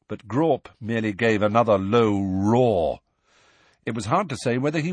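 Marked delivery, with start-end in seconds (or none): none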